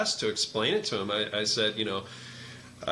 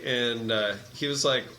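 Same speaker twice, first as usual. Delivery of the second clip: normal talking voice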